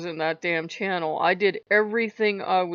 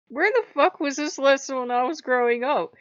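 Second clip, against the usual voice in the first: higher, louder indignant rant; exasperated outburst; 7 semitones higher, 5 dB louder than usual. Retold or imitated. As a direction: demand it of the past in outrage. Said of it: "I'm like".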